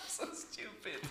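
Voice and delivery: cracking up. Real, not acted. Host cracking up at mistake.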